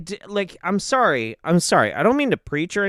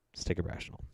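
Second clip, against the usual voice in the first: whispered calm; 17 dB quieter, much faster than usual. Real, not acted. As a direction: whisper it to yourself. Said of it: "Let's calm down".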